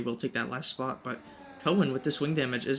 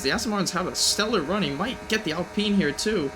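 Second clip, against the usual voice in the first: higher, more excited race call; motorsport commentator; 6 semitones higher than usual. Real, not acted. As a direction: call the crash live, building excitement. Battle and crash called live.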